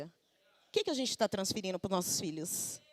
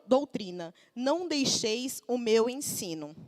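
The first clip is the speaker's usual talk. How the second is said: loud, slow solemn reading of Proverbs; scripture reading; 3 semitones higher, 7 dB louder, slower than usual. Acted. Preacher reading Proverbs aloud to the congregation, loud and solemn. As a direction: proclaim the verses to the church.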